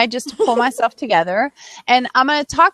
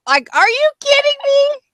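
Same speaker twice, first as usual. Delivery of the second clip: much higher, incredulous; mock disbelief; 14 semitones higher, 4 dB louder than usual. Real, not acted. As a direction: roll your eyes as you ask. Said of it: "I'm rolling my eyes right now".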